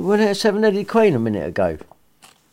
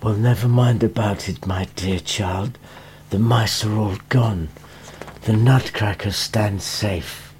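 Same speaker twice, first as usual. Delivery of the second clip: soft doctor; 7 semitones lower, 4 dB quieter than usual. Acted. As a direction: gently soothe a sick child. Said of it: "the doctor said softly".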